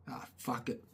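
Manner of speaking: put-on a kid's sly whisper, deciding. A boy on the roof deciding to drop lead, whispered. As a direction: whisper it under your breath.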